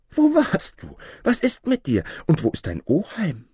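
high, urgent mother calling with questions. A narrator's reading. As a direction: fire worried questions up high. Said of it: "rief sie". (hoch rufende, aufgeregte Mutter)

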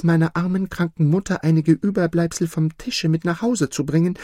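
put-on high, childlike girl's voice; little kitchen girl. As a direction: speak as a poor little girl, tender. Poor kitchen girl speaks.

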